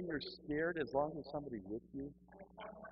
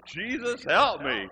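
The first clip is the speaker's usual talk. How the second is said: louder, higher comic cry; desperate mock plea; 5 semitones higher, 11 dB louder, much slower than usual. Kneeling alone as they approach, loud desperate mock plea. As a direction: burst out begging for rescue.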